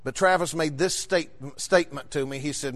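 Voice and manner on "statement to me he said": normal talking voice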